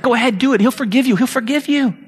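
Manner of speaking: high, coaxing, wheedling devil; tempting devil voice. Acted. Preacher voicing the devil's coaxing lie to a believer, high and wheedling. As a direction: sweet-talk the sinner like a tempter. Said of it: "you find yourself in these places, like".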